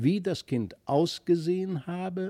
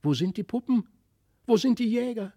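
put-on higher, eager child voices; children calling; 8 semitones higher, 3 dB louder, faster than usual. A narrator's reading. Felix and Christlieb calling for their lost toys, childlike. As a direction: ask like worried little children. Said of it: "Christlieb und Felix riefen".

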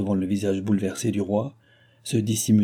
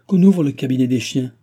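loud, raised-pitch royal order; loud command; 4 semitones higher, 7 dB louder than usual. Acted. King orders the officer to open the kennel, commanding. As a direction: call the order out firmly.

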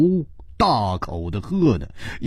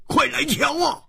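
startled cry; faster than usual. Acted. Hu Tiehua finding the note under the vase, startled cry. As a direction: call them over in sudden alarm. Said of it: "一声惊呼说".

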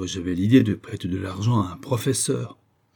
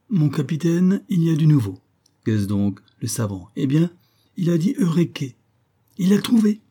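high, louder, bursting with the news; excited report; 7 semitones higher, 4 dB louder than usual. Acted. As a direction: blurt out the news to the captain. (rapport excité, plus aigu et plus fort)

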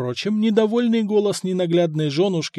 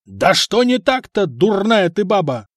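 higher, louder angry outburst; exploding; 6 semitones higher, 6 dB louder than usual. A narrator's reading. Blacksmith exploding at his wife, angry. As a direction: blow up at her. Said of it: "взорвался кузнец".

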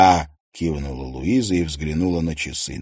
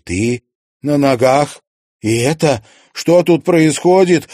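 higher and louder, slow and stunned; shocked questions; 8 semitones higher, 7 dB louder than usual. Acted. Daughter discovers her sick mother walking, shocked loud questions. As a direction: demand answers in disbelief.

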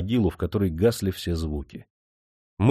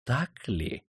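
soft, cold doubt; quietly suspicious; 3 semitones higher, 8 dB quieter than usual. A narrator's reading. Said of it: "прищурив жёсткие глаза".

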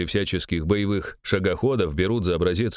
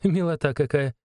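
kind smiling; 7 semitones higher than usual. A narrator's reading. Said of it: "добро улыбнулась ей Ирина".

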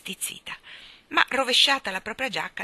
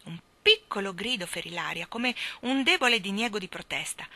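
ordinary delivery, nothing marked

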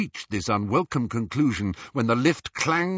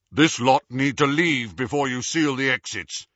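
authoritative; 2 semitones higher, 4 dB louder than usual. A narrator's reading. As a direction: speak with full authority. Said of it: "with all the authority he could muster".

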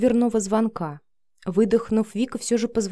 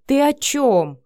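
louder, higher indignant question; matching her heated tone; 6 semitones higher, 5 dB louder, slower than usual. A narrator's reading. Matvey answering Vika's accusation, just as heated. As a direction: hurl back a baffled challenge. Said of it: "в тон ей ответил Матвей".

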